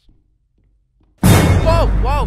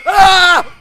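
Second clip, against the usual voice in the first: scared scream; 20 dB louder than usual. Real, not acted. Jump scare in game.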